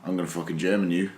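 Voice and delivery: lightly voiced low, quick threat; mock threat. Retelling Brock coming out after Daniel, mock threat. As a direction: mutter it low and soft, imitating a menacing wrestler.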